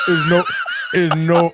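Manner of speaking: breaking into laughter; laughing. Real, not acted. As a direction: laugh through the words. Laughing at garbled caller.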